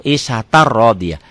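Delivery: "Isatar rodiyah" with a doubled R; single vowel, no tanwin. Shown as a demonstration. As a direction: join "Isatar" to "rodiyah" without N. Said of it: "patah tainnya baca satu".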